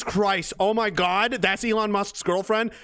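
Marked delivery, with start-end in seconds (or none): none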